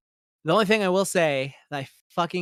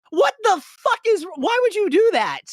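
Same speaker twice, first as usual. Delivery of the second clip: disbelieving outrage; outraged; 5 dB louder than usual. Real, not acted. Reacting to the teacher being kicked out, outraged.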